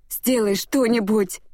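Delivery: high, slow, loud plea; desperate shout. Acted. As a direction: scream it at the guardian. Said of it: "закричала я покровителю".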